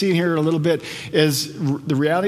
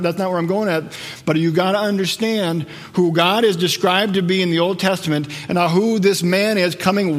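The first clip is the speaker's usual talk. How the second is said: ordinary talk, nothing marked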